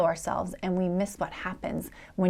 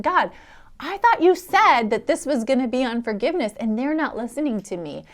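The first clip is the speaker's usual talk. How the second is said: exasperated plea; 5 semitones higher, 5 dB louder than usual. Retold or imitated. Frustrated complaint to God.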